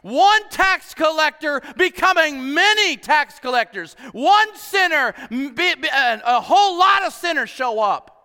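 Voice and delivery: loud, high, slow preacher's build; full-force preaching. Real, not acted. Pastor to his church, preaching loud and fervent. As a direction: preach it out, shouting the growth.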